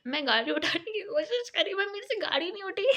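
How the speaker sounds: हँसते हुए. Real, not acted. हँसते हुए गाड़ी उठाने की कोशिश.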